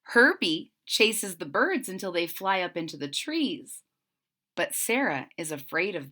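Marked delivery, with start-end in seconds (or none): none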